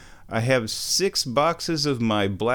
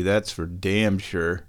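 ordinary talk, nothing marked

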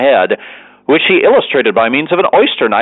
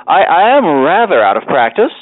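higher-pitched, stumbling start; hesitant excuse; 4 semitones higher than usual. A narrator's reading. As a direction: back out awkwardly, voice raised in pitch. Winkle makes a hesitant excuse.

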